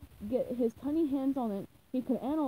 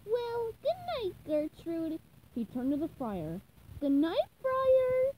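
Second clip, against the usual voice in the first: higher, slow tender goodnight; fond goodnight; 8 semitones higher, slower than usual. Acted. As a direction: tuck the grill in. Fond goodnights to kitchen things.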